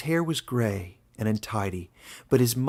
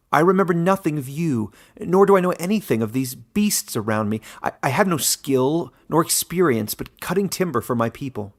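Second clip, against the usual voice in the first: faster, louder, stumbling denial; nervous stammer; 4 semitones higher, 6 dB louder than usual. Acted. Caleb nervously protesting to the warden he's mistaken, louder and stumbling. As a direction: protest anxiously, tripping over the words.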